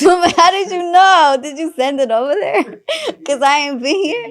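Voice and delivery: high and loud, breaking into laughter; can't hold back the laugh. Real, not acted. Telling friends about the priest, laughing hard and high.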